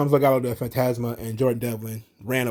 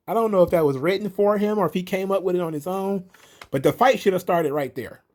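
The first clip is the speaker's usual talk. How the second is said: ordinary talk, nothing marked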